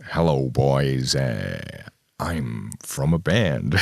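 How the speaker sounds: low, slow, bad American accent. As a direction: put on a clumsy American drawl, pitched low. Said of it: "bad [name] doing a bad American accent".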